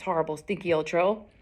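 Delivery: plain reading voice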